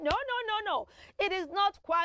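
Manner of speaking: ordinary delivery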